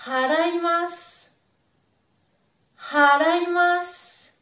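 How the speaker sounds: haraimasu with a clearly breathed initial H; breathy H. Shown as a demonstration. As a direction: push air on the H of haraimasu. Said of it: "il faut bien prononcer le H". (haraimasu avec un H bien expiré)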